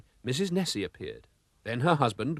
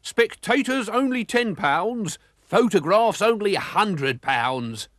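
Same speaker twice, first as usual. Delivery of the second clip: much higher, slow hawker's pitch; grinned; 8 semitones higher, 4 dB louder, slower than usual. A narrator's reading. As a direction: sell it with a sly grin. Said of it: "grinned McClurk".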